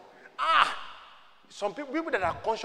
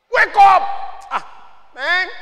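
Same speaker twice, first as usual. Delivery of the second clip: high, very loud pulpit shout; sudden full-voiced command; rousing shout; 11 semitones higher, 11 dB louder, slower than usual. Real, not acted. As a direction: jolt the congregation awake. Rousing the congregation from crying.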